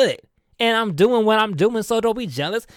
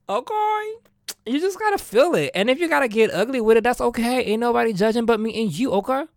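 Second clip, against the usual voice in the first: ordinary delivery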